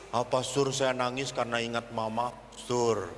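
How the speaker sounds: low, subdued confession; acted downcast child's reply; contrite girl. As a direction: answer meekly, drop well down. Girl tearfully confessing to the pastor.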